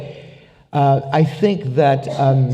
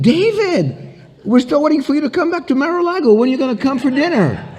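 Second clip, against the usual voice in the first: high, gushing mock-delighted voice; delighted phone greeting; 11 semitones higher than usual. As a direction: play a big shot thrilled to hear his buddy. President answers his pal, delighted.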